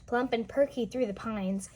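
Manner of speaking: usual speaking voice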